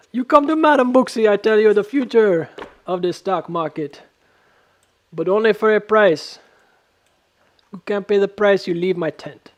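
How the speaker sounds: hammed-up, high-pitched Jamaican fortune-teller; Jamaican accent. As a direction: put on a really horrible Jamaican accent. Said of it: "Really horrible Jamaican accent".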